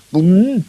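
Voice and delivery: loud, high, drawn-out exclamation; winded gasp of shock. Acted. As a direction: cry out as the ball hits your belly. Goalkeeper hit in the belly.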